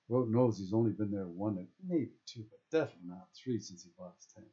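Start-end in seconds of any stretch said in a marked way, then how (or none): none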